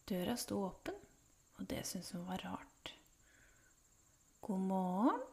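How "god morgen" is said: calling out. A narrator's reading. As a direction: call it out with a lilting rise. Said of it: "ropte hun".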